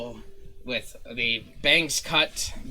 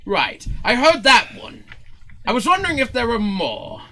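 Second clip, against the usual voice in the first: high, loud, theatrically incredulous; condescending showman; 11 semitones higher, 8 dB louder than usual. Acted. Showman asking if Zook has more words, condescending. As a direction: question them with haughty, exaggerated puzzlement. Zin baffled by one-word answers.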